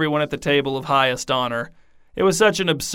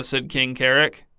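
ordinary delivery, nothing marked

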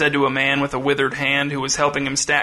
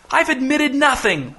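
high, drawn-out outcry; roared denial; 11 semitones higher than usual. A narrator's reading. Accused captain denies confessing to the crew, high roar. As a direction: cry it out slowly, pitched far up. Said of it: "roared Captain Burkess".

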